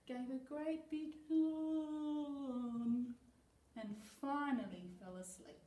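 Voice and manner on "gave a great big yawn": very slow, stretched like a yawn; yawning narration. A narrator's reading. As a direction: draw it out like a yawn.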